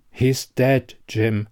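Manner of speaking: ordinary delivery, nothing marked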